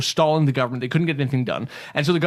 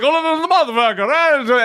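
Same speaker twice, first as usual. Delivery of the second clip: higher, hammed-up Italian man swearing; imitating Italian neighbor; 9 semitones higher, 4 dB louder than usual. Salesman retells an Italian customer cursing about his neighbors, in a mock Italian accent. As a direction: mimic an angry Italian guy, pitched up. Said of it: "started talking about his neighbors".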